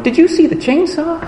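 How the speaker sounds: put-on a little kid blurting a wild detail; excited kid. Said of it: "And the other one's going".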